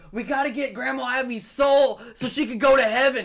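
high, loud imitation of an urgent kid; acted high-pitched kid quote; child's words retold. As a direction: push the pitch up like a child announcing a mission. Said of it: "And she said".

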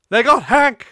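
high, loud acted anguish; distraught outburst. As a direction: cry out the terrible news. Crying Billy reports Hank's capture.

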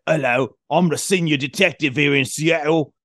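mock a British movie cop introducing himself; big, put-on British accent. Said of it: "he's still got his, like, British accent".

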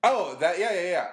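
ordinary talk, nothing marked

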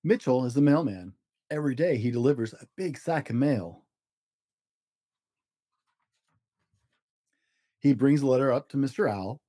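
gentle storybook narration with small character voices; reading to children. A narrator's reading. Dad reading a story to his kids, storybook voice. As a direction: read it like a bedtime picture book. Dad reads picture book aloud.

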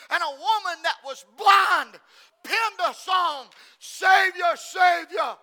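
loud, emphatic preacher's proclamation; full-force preaching. Acted.

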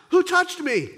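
sharp demand from the crowd; stern Jesus. Acted. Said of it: "Jesus seems to be stern here".

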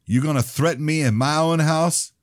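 sneering shout of the bully cousin; goading taunt. Acted. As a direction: bait him into a fight. Ronnie goading Joshua to fight.

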